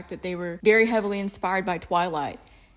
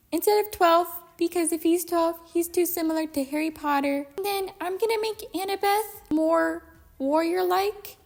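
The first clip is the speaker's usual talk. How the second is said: high-pitched mocking voice; mocking dopey quote; 8 semitones higher than usual. Ranting fan quotes the filmmaker changing Percy's age, mockingly. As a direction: put on a high, silly voice for the quote.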